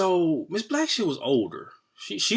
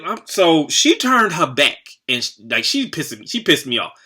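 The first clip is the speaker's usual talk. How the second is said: ordinary delivery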